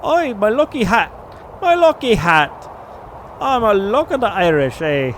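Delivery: hammed-up, high fake Irish accent; mock Irish accent over a lucky hat; bad Irish accent. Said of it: "That was not even an Irish accent".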